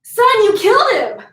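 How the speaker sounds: a hammed-up trickster father turning on his son; shocked Anansi. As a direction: blame the son in a hammed-up outraged voice. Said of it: "And Anansi's like".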